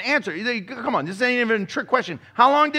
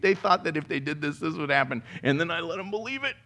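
amused parent's aside; 5 semitones lower, 6 dB quieter, faster than usual. Acted. Parent chuckling aside about kids.

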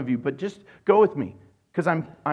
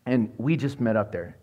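ordinary talk, nothing marked

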